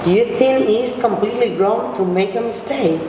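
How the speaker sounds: usual speaking voice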